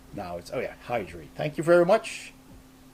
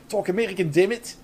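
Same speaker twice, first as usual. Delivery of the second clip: mock-forceful order in a thick Dutch accent; Dutch-accented English, hammed up; horrible Dutch accent; 5 semitones higher, 5 dB louder, faster than usual. Shown as a demonstration. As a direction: exaggerate the Dutch accent, a little louder. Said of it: "horrible Dutch accent".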